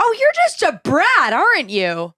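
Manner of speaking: very high, drawn-out scornful scold; impression of scolding older woman. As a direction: imitate a cranky stranger telling someone off. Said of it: "I'm doing a verbatim impression".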